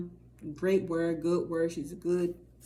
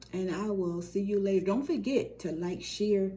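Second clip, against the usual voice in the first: normal talking voice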